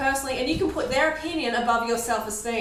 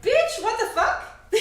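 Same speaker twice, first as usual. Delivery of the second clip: slow, much higher mock indignation, laughing; mock outrage; inner outrage; 10 semitones higher, much slower than usual. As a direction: let the inner reaction out slowly, laughing. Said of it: "inside I'm like".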